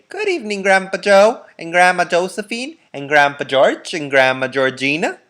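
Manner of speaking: put-on a happy boy calling hello to his grandparents; high eager child greeting; Charlie's greeting. A narrator's reading.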